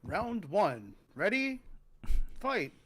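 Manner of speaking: big announcer call, pitch climbing high; fighting game announcer. Acted. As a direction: call the round like an arcade announcer.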